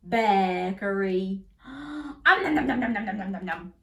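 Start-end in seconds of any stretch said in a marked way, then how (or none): none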